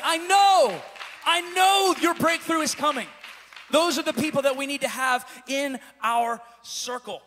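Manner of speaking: loud, building revival proclamation; full-force preaching. Real, not acted. Preacher urging the church toward encouraging people, full-force preaching. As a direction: build to a shouted declaration of faith, then ease off.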